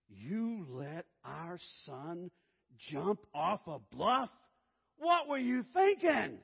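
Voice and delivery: slow, rising to loud, high outrage; angry wife. Retold or imitated. Wife scolding her husband for the bluff, slow rising shout. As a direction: scold him, building to a shout.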